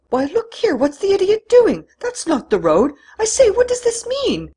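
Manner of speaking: high, loud alarmed outburst; alarmed surprise. Acted. Don Luis realizes the car is going the wrong way, alarmed. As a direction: shock rising into indignation.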